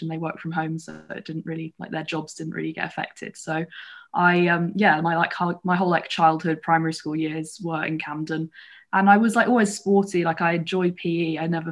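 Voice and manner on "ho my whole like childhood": normal talking voice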